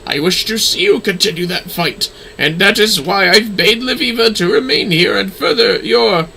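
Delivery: cool, superior lecture; lightly voiced stern lady. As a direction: scold a disappointing former pupil. Lady's long speech.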